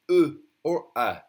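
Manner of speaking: "E" and "A" as the two French eu vowels; French EU sounds. Shown as a demonstration. Teacher showing French learners the EU sound, both vowels demonstrated. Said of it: "that's the sound".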